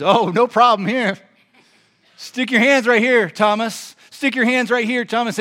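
high, loud, ready reply; eager invitation. Acted. Jesus answering doubting Thomas, eager loud invitation.

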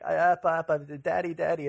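lightly acted child's rising panic; mock frantic toddler. Toddler hunting for hidden Daddy, imitated frantic. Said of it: "getting more and more frantic".

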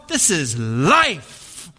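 very slow, hushed pronouncement of "this is life"; mild imitation of a fervent baker; solemn baker. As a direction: drag out "this is life" like a sacred truth. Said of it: "this soup Nazi".

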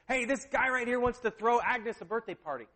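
retold call to someone out of sight. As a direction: shout the news through to the kitchen. Calls wife from the back.

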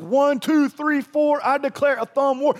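high, loud playground chant declared; mock declaration. Pastor mocking Peter's grand vow, loud playground chant. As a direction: shout it like a kid's thumb-war challenge. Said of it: "He declared it like".

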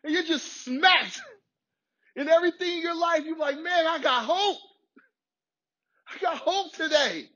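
high, building preacher's cry of hope; rising to an exultant shout of hope; excited. Acted. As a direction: lift it up, rising joy.

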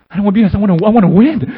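player yelling high and loud that he wants to win; desperate shout. Retold or imitated. Beaten player shouting to his teammates at halftime, desperate and loud. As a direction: cry it out loud, sick of losing. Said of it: "feeling like".

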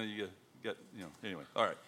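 ordinary delivery

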